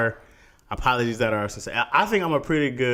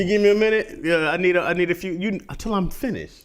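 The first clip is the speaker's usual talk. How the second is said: higher, louder pleading stall; mock gamer stalling; 9 semitones higher, 3 dB louder than usual. Gamer stalling his partner mid-game, higher mock plea. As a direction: beg for one more minute.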